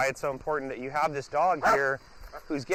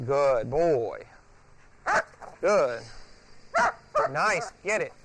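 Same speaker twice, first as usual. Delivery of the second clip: loud, keyed-up praise for the dog; loud happy cheering at the dog; excited praise; 4 semitones higher, 3 dB louder, much slower than usual. Real, not acted. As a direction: hype the dog up as he plays. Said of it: "let him get really excited".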